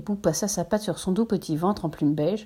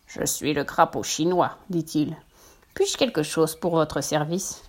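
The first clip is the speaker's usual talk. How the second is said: slow, lower croaking toad; 4 semitones lower, slower than usual. Acted. Toad greeting the travellers, slow and low. Chinese toad introduces himself.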